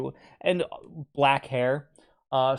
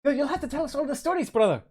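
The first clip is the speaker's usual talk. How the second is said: put-on German accent; 10 semitones higher, faster than usual. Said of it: "My German accent is atrocious".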